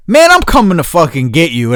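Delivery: insistent friend. Retold or imitated. Best friend insisting on picking him up, insistent. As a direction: override the refusal firmly.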